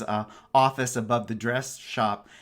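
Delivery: ordinary talk, nothing marked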